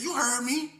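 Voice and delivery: high, loud taunt; dog talking back. Retold or imitated. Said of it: "it's like he said".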